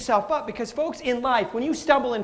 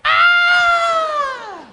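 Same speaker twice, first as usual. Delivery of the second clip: high, loud mock baby wail; 7 dB louder than usual. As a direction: wail like a toddler fishing for attention. Adults crying out like toddlers.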